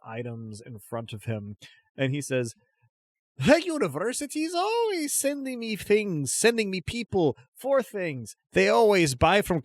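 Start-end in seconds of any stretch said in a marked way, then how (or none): 3.4-9.6 high-pitched, excitable crazy stallholder; eccentric trader. Acted. Crazy stallholder pitching to a customer sent by the university, high and eccentric. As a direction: play an oddball merchant high above your own voice.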